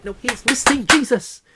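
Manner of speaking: high, loud exclaim on missing; excited revelation. Acted. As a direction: proclaim it like a revelation. Preachy reveal.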